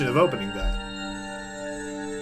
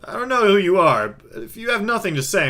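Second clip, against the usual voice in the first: firm warning to caller; 6 semitones higher than usual. Acted. Warns the silent caller.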